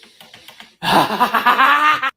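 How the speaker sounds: sudden high burst of laughter; loud laugh. Real, not acted. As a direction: crack up again. Laughing at clip again.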